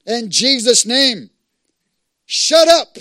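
loud, high command with drawn-out words; shouted rebuke. Acted. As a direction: shout down the enemy with full authority. Rebuking the enemy.

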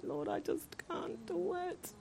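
tiny, high, slow hushed plea; dreading plea. Retold or imitated. Reluctant preacher pleads with the Lord, dreading it. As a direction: squeak it out faintly in mock dread. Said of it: "feeling like my belly was dropping".

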